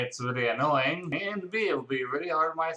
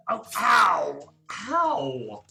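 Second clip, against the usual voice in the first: loud fake yelp of pain; mock pained cry; 4 semitones higher, 4 dB louder, much slower than usual. Reacting to the hero's edgy name, mock yelp.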